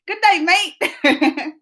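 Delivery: slow, high Australian greeting; Australian accent. Retold or imitated. Streamer retrying the greeting for Winter, Australian accent. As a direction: stretch out a playful Australian hello. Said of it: "Do I sound like Australian?".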